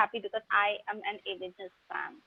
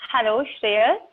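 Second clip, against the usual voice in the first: loud call into the mic; shouting into a phone; 4 dB louder than usual. Real, not acted. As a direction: raise your voice to reach someone. Calling out to absent call participants.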